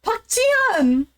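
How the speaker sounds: high, stretched-out call of a name; mock-dramatic plea, name drawn out; imitated calling after her. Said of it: "she starts walking away and he's like".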